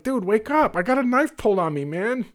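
panicked mock shout at a passed-out cop; retold urgent shout. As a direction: shake the cop awake with your voice, fast. Kicking passed-out cop awake.

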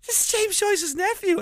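high, slow excited proclamation; acted amazed announcement. Gardener telling everybody in the studio, acted amazement. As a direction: announce it to all in mock amazement. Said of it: "I was telling everybody in the studio".

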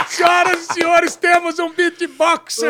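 gritando lento e alto; anúncio empolgado. Real, not acted. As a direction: anuncie como apresentador de circo. Anunciando o beatboxer, empolgado. (anúncio eufórico, alto e arrastado)

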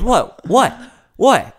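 high, loud repeated what what what; Larry David baffled by the room, high indignant what. Retold or imitated. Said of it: "And he goes".

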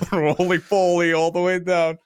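put-on goofy Russian accent breaking into laughter; a silly Russian voice, half laughing; Russian accent cracking up; mock Russian accent. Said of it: "doing a Russian accent for most of the video".

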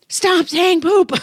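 high, slow, loud scold; shrill-free exaggerated parental yell; mock scream. Mother screaming at kids at table, high slow scold. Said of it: "better than screaming at them".

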